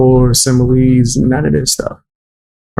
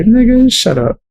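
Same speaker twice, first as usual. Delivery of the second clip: scoffing dismissal; 9 semitones higher than usual. Real, not acted. Dismissing chat's praise.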